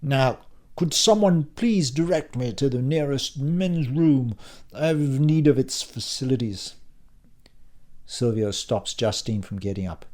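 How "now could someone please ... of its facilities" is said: put-on Oxbridge accent, pitched up; posh voice. Tsar asks for the men's room in posh English. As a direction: ask with mannered posh English politeness. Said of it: "He reverts to his posh voice".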